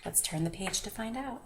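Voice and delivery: ordinary delivery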